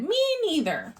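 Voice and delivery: put-on baby kangaroo siding with mom; tiny joey voice. A narrator's reading. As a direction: chirp it like a small echoing child. Baby kangaroo echoes mother.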